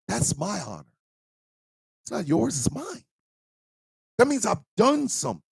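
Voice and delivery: high, choked-up earnest speech; high, trembling heartfelt declaration; tearful sincerity. Real, not acted. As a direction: speak through tears of pride. Emotional, high-pitched sincere speech.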